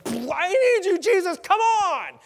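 high, very loud desperate plea; desperate Jairus. Acted. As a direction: shout it out, begging for urgent help. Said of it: "the opposite of Jairus".

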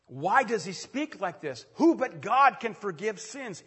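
loud, slow accusing question; indignant leaders. Acted. Religious leaders objecting to Jesus, loud and scandalized. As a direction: challenge him, scandalized.

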